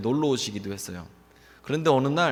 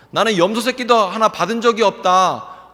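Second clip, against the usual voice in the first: higher, louder bitter complaint; the older brother's wounded outburst; aggrieved; 7 semitones higher, 8 dB louder than usual. Retold or imitated. Older brother voicing his grievance, higher and louder. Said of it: "형이 얘기하는 게 억울하다는 거예요".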